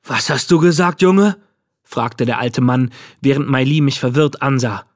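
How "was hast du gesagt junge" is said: put-on rising, indignant old man's question; sharp challenge. A narrator's reading. Old man to the boy who called him thief, challenging. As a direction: demand an answer, rising in pitch and volume.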